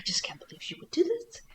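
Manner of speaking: hushed, disbelieving whisper. Retold or imitated. Muttering to herself over a shocking plot turn, whispered. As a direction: whisper it to yourself in dismay.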